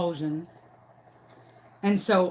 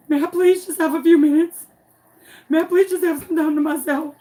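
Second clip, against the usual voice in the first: high, loud flustered plea; pleading teacher; 7 semitones higher, 4 dB louder, faster than usual. Retold or imitated. Teacher begging the observer for a minute alone, high and flustered. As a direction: beg for a moment, on the edge of tears.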